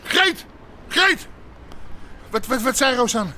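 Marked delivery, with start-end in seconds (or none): 0.0-1.3 calling out. Acted. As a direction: shout for Greet urgently. Calling out to Greet. (luid roepen)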